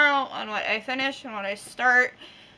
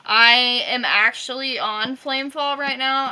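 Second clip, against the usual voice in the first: ordinary talk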